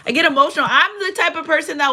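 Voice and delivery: high, loud burst of real feeling; emotional. Real, not acted. Host after honoring veterans, openly emotional. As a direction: blurt it out, moved.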